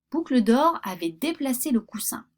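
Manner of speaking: plain reading voice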